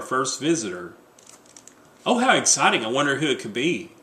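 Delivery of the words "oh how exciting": high, eager exclaim; excited gush. Acted. Wife hearing the first visitor knock, excited.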